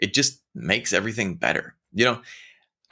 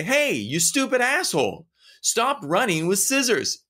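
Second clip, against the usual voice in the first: much higher, rude yelled-at-a-stranger jab; hammed-up rude yell, much higher; mock insult; 10 semitones higher than usual. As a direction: bark it like an annoyed passerby. Insulting someone running with scissors.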